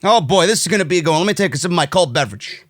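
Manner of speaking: normal talking voice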